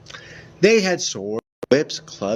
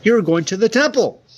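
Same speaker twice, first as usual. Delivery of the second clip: excited announcement; 9 semitones higher, 6 dB louder, faster than usual. Acted. Excited narration burst.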